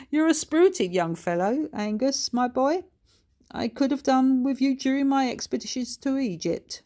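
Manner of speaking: amused grandfather to a feisty pup; lightly voiced cheerful old ghost; hearty Harold. Harold to the dog.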